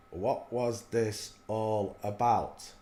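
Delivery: every word stretched out slowly; slow it right down, as if puzzling it over; slow, drawn-out repeat. Shown as a demonstration. Repeating his question to viewers, slowly.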